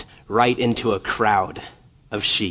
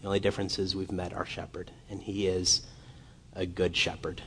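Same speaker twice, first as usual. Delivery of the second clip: softer, quiet assurance; soft reassurance; 8 dB quieter than usual. Acted. Preacher assures the flock that the shepherd is good, quietly. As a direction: lay it down gently for them. Tender close of the sermon.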